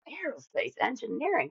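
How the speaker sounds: replaying her own dawning surprise at the idea; surprised realization. Recalling her college search, mock surprised question. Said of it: "And I'm like".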